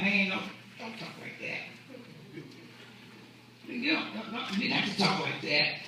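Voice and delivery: soft, near-whispered plea; hushed aside. Acted. Peter taking Jesus aside to object, hushed plea.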